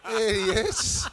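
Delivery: bursts out laughing, high and amused. Real, not acted. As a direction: laugh through the greeting at the heckler. Heckler interrupts press conference.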